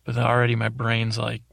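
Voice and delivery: usual speaking voice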